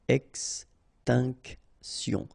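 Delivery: very slow "Extinction", ex-tinc-tion; syllable by syllable. Shown as a demonstration. French teacher modelling for learners, "Extinction" slowly syllable by syllable. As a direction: pause between each syllable of "Extinction".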